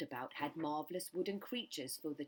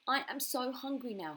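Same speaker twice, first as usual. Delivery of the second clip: greedy crocodile; 8 semitones higher, 5 dB louder than usual. A narrator's reading. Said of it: "he said to himself".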